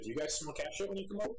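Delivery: normal talking voice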